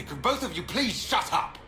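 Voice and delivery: high, swooping, fed-up outburst; exasperated plea. Acted. Breaks up two arguing companions, exasperated. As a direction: cut the squabble off in exasperation.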